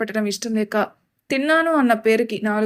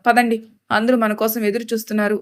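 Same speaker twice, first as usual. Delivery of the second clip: usual speaking voice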